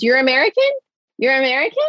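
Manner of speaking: high, incredulous questioning; stunned disbelief. Retold or imitated. Rescued hostage asking her rescuers, high disbelief. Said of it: "all I can say over and over again is".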